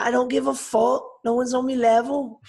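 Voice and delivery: high, cocky mock Liverpool Scouse accent; bad Scouse accent. Darren Till brushing off the rankings, in a mock Scouse accent. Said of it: "Again, in my shit Scouse accent".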